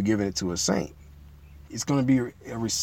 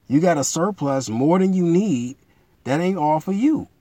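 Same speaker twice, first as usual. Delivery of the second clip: building sermon fervor, higher and louder; rising preaching; 5 semitones higher, 4 dB louder than usual. Real, not acted. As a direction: preach it harder, climbing toward the punch.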